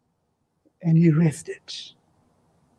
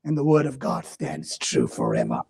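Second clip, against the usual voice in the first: hushed low proclamation; 8 dB quieter than usual. Acted.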